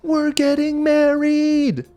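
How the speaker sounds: high, slow and thrilled; excited announcement. Retold or imitated. Nick telling the men's quarters, thrilled and drawn out. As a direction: announce it like big news. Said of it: "he's so excited".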